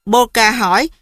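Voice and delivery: ordinary delivery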